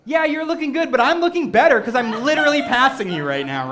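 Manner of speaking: hammed-up one-upping; mock smug passer-by. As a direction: gloat as you run past someone. Voices passing runners' smug subtext.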